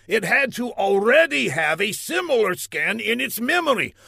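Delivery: interrogation building to high, loud demand; indignant villain. A narrator's reading. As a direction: grill the scientist, ending in a shout.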